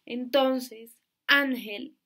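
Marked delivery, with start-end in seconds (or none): 1.3-2.0 "ángel" loud on ÁN; stress on penultimate syllable. Shown as a demonstration. Teacher explaining the accent, "ángel" stressed loudly on ÁN. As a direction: hit the first syllable of "ángel". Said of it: "la penúltima sílaba".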